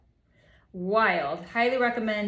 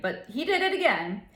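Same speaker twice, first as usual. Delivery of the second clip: usual speaking voice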